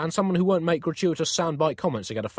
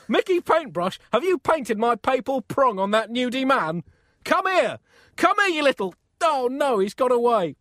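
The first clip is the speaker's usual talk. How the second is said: very high, loud comic rage; furious yelling; 11 semitones higher, 5 dB louder than usual. Acted. Pope chases the fleeing painter, yelling in hammed-up fury. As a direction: yell after a culprit who's escaping.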